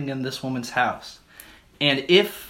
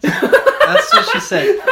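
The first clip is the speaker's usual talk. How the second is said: much higher, louder and slow, through laughter; laughing joke; 11 dB louder, slower than usual. Real, not acted. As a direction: crack up while saying it.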